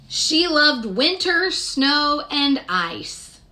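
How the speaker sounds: ordinary delivery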